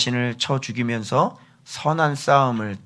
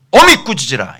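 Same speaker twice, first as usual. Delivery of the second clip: louder, forceful quoted command; stern rebuke; 6 semitones higher, 12 dB louder than usual. Shown as a demonstration. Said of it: "이렇게 얘기하는 거예요". (엄하게 힘주어 꾸짖듯)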